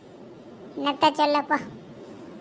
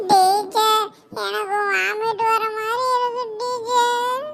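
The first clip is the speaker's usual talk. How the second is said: high, slow mock-crying wail; tearful whining schoolgirl; 6 semitones higher, slower than usual. Sheela tells teacher she feels sick, crying whine. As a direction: wail it to teacher, dragging every word. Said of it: "எதுக்குடி அழுவுற?".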